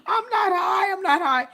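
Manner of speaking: loud, high, laughing insistence; laughing denial. Retold or imitated. Denying to grandma while laughing, loud high denial. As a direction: deny it while cracking up. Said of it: "I'm telling her".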